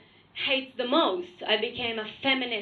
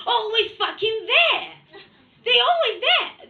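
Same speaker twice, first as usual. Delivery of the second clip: exasperated rant; 7 semitones higher, 6 dB louder than usual. Acted. Exasperated rant about her kids.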